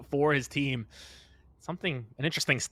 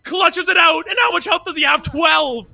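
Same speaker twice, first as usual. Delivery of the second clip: esports caster; 13 semitones higher, 10 dB louder than usual. Acted. Clutch round call.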